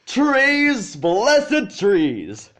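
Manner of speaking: a rapturous cry of relief at the sight; delighted. A narrator's reading.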